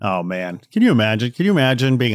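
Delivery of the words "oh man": ordinary delivery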